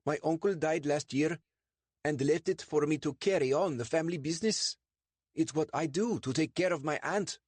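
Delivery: high, over-eager innocent voice; nervous Sandeep. Acted. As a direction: talk your way past the police, a touch too high. Sandeep's cover story.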